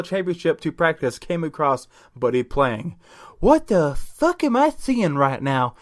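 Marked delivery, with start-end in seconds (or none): 3.4-5.8 high, slow voice of disbelief; astonished player. Acted. Tallest player sees the guillotine playing, astonished. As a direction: stretch it out in stunned amazement. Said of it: "the tallest player said aloud".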